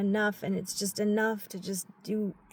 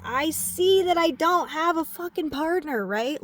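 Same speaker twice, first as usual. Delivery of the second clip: louder, higher, furious venting; frustrated rant; 4 semitones higher, 5 dB louder than usual. Real, not acted. Single mom ranting about being alone, loud and angry. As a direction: spill it out in an angry rush.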